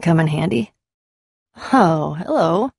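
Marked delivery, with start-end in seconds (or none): none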